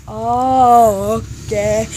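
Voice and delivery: mock a dubious, drawn-out acknowledgement; unimpressed reply. Desk lady answering the hurt-leg excuse, low unimpressed drawl.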